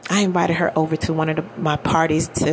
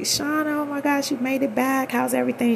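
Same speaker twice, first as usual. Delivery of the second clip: higher-pitched imitation of a glad welcome; mildly delighted, pitched up; retold happy greeting; 8 semitones higher than usual. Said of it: "she was happy to see me".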